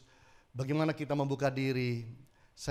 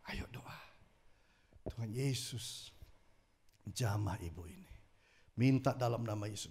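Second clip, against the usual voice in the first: quiet prayer; 4 semitones lower, 4 dB quieter than usual. Acted.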